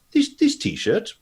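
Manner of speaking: high, slow showman reveal; presenting the shirt. Acted. Leonard showing the gang his t-shirt, high and slow. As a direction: hold it up and announce it. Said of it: "and say".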